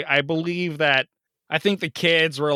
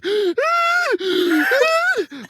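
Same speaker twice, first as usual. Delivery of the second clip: hammed-up gasp; 3 dB louder than usual. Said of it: "on the phone".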